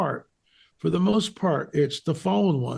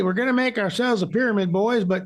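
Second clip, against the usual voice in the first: mock Southern builder, pitched up high; Southern accent; 8 semitones higher than usual. Guest plays pyramid builder rallying the boys, Southern accent. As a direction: play a clueless Southerner in a high voice. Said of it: "that was a good accent".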